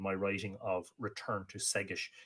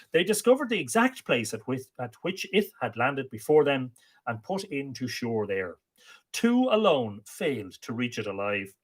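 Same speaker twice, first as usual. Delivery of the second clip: lively, slightly raised storytelling read; story narration; 4 semitones higher, 6 dB louder than usual. A narrator's reading. Storyteller reading an Irish myth to listeners, lively.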